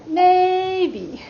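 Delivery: drawn-out high, level MAY, then dropping BE; highest pitch. Shown as a demonstration. Teacher finds the highest pitch, stretched high maybe. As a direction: hold MAY high and long before falling. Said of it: "find your highest pitch".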